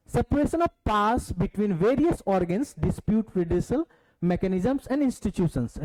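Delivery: slow reading aloud. Shown as a demonstration. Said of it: "reading syllabus points aloud slowly".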